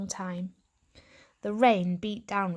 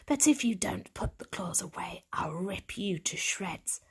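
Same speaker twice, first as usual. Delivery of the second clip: gravelly threat; 5 dB quieter than usual. A narrator's reading. Stray cat threatening the newcomer, rough whispered menace. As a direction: menace him quietly through a rasp. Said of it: "Something in her gravelly voice".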